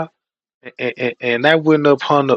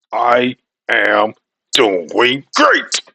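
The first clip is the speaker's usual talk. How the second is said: choppy, mechanical robot words; 5 semitones lower, 4 dB louder, slower than usual. Retold or imitated. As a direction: speak one stiff word at a time like a machine. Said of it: "[name] sounding like a robot".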